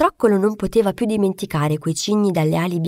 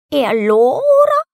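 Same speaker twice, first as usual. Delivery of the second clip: high, loud, slow hen voice; a hen puffed up and scolding; 6 semitones higher, 8 dB louder, slower than usual. A narrator's reading. Hen scolds the duckling, high and loud. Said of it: "la gallina gli chiese ancora".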